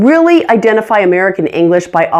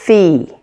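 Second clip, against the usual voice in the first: the "the" said alone as a strong syllable; stressed first syllable; 3 semitones lower than usual. Shown as a demonstration. Teacher isolating the primary stress for learners, stressed first syllable. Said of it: "The first has the primary stress".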